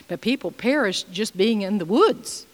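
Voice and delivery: ordinary talk, nothing marked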